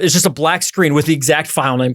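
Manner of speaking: usual speaking voice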